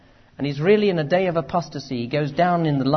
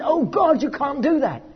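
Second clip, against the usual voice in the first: much higher, loud horrified plea; pleading Moses; 10 semitones higher, 3 dB louder than usual. Retold or imitated. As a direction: beg God to stop, aghast. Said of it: "He said".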